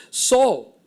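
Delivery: calling out. Retold or imitated. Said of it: "and then he calls out and he says".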